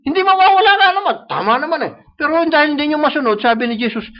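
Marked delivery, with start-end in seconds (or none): 0.0-1.1 mock agreement. Mock agreeing with false teaching.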